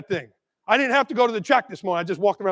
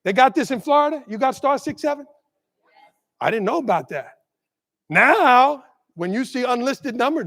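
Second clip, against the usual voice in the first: ordinary talk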